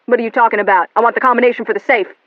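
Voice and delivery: loud, fast; angry demand. A narrator's reading. William demanding the safe combination. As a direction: bark it at her.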